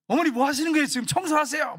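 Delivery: high, loud scolding of a mother-in-law; retold yelling. Daughter-in-law turns on her mother-in-law once husband leaves, retold yelling. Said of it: "막 소리 지르는 거야".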